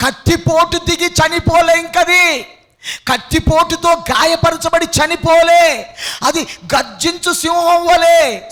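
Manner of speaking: high, loud, roaring sermon shout; full-force preaching. Real, not acted. Preacher explains the wounded roaring lion, loud high shout. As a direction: roar the point at the congregation.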